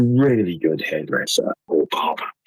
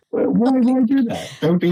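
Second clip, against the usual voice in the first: high, disbelieving rhetorical cry; much higher, exasperated why; incredulous; 3 dB louder than usual. Real, not acted. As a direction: squeal out the disbelief.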